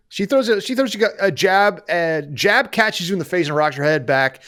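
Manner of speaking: ordinary talk, nothing marked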